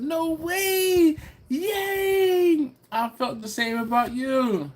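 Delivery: high, gleeful exclamations; acted squealing excitement, easing slightly at the end; delighted squeal. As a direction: read it out as an overjoyed high cheer. Voicing Susie's delighted chat message.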